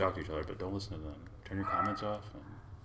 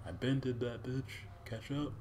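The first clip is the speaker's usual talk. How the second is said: high-pitched, dismissive universe voice; cocky universe; 8 semitones higher than usual. Acted. The universe answering astronomers' new discovery, cocky high voice. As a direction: play the universe as an unimpressed show-off. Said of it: "Universe is like".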